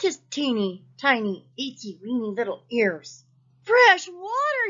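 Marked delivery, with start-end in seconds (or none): 3.6-4.7 loud, high, slow cry; shout. A narrator's reading. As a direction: shout it out with joy. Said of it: "he shouted".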